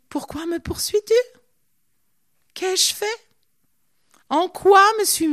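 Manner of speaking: high, loud pleading questions; questions plaintives. Acted. David pleads with Saul. (supplication interrogative, aiguë et de plus en plus forte)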